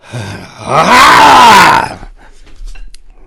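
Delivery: heavy loud sigh. A narrator's reading. Narrator heaves sigh after killing.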